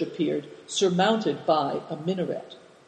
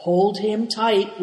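plain reading voice

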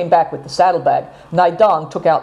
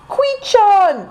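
put-on high, pleading cry of a young girl; pleading girl's cry. A narrator's reading. Seven Stars begs Queechan not to jump, high pleading cry. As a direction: beg him not to go, pitched up high. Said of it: "cried Seven Stars entreatingly".

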